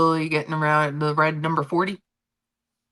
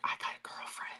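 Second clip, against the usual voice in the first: imitating a kid in a whisper; whispered kid quote; 18 dB quieter than usual. Streamer imitating a boy telling his secret, whispered. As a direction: whisper it like a proud little kid. Quoting an eight-year-old.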